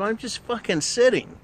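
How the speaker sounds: irritated answer to the ex; exasperated reply. Retold or imitated. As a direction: snap back at her, annoyed. His reply in quarrel.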